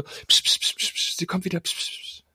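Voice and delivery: whispered hush, fading to nothing; whispered shushing between drunk lads. Retold or imitated. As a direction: hiss the boys quiet as she returns. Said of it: "haben die echt so zwischendrin so".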